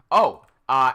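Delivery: ordinary delivery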